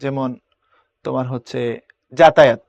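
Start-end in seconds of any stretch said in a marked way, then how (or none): 2.1-2.6 যাতায়াত opening on a hard j, louder; pressed j. Shown as a demonstration. Teacher giving an example, যাতায়াত with firm pressed j. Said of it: "এটার উচ্চারণ চাপ দিয়ে হয়".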